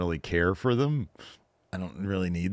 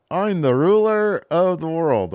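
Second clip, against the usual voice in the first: slow, high, loud proclamation; Obafemi; 9 semitones higher, 5 dB louder than usual. Acted. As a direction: boom out the catchphrase, drawn out. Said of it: "whatever Obafemi calls himself".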